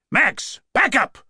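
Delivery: high-pitched urgent warning; urgent shout. A narrator's reading. Cop needing a clear shot at partner's attacker, urgent shout. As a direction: call out to pull a partner back.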